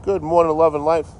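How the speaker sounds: high, loud cheery hello; bright greeting. Real, not acted. Greeting a new viewer with a high loud good morning. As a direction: call out a happy good morning.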